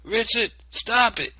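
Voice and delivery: high, loud command; shout. Acted. Mother ordering the boy to stop, loud shout. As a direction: shout him down. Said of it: "she shouted".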